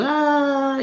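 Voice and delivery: high-pitched, drawn-out "aah"; playful high "aah". Retold or imitated. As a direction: imitate squealing play with little kids. Imitates playful greeting of children.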